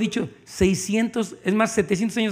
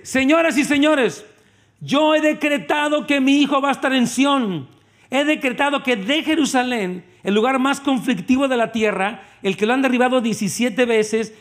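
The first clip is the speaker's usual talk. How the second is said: grand proclamation; 3 semitones higher than usual. Acted. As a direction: announce it like a herald to the world. God proclaims decree.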